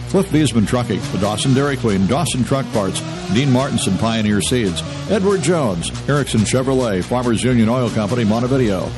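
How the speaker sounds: ordinary talk, nothing marked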